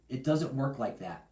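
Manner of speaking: ordinary delivery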